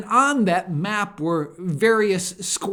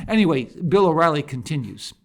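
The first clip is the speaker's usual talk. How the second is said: ordinary talk, nothing marked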